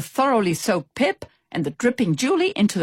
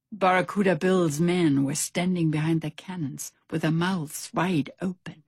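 plain reading voice

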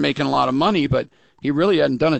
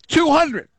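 talking through a yawn; words stretched by a yawn; 13 semitones higher than usual. Real, not acted. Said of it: "I don't know why I'm yawning".

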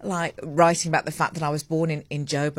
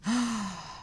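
soft pleased gasp of delight; 4 semitones higher, 7 dB quieter than usual. Real, not acted. Host gasping with delight at the weather. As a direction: gasp at the good news.

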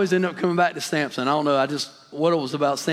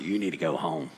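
gruff uncle; 13 semitones lower, 8 dB quieter than usual. Retold or imitated. Uncle sends the boy home, low gruff voice. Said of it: "He had his gruff voice".

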